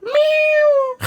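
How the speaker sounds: cat meow. Acted. Imitation de chat.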